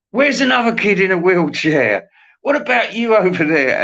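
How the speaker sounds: high, hammed-up operator voice calling out; lightly voiced stagehand picking a kid; mock operator. Operator scanning the crowd, in a high hammed-up call.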